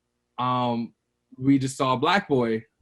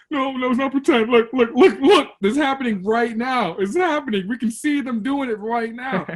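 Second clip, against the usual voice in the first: put-on high, loud, panicked child's voice, nearly hyperventilating; a terrified seven-year-old pleading through tears; panicked crying kid; 11 semitones higher, 7 dB louder than usual. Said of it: "And I'm hyperventilating".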